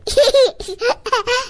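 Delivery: put-on high childish giggle; teasing giggle. Child seeing Sonam's cropped hair, teasing giggle. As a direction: giggle like a cheeky small child.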